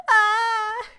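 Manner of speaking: loud high startled scream. Acted. Damien scared as the movie starts, loud high scream. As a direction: shriek in fright at the lights going down. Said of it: "Damien screams".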